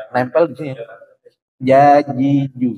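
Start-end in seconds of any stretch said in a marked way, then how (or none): 1.6-2.7 "Jajiju" slow, falling at the end; slow syllable drill. Shown as a demonstration. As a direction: model "Jajiju" slowly again. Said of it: "itu masih ada dikit ya". ("Jajiju" pelan dan turun)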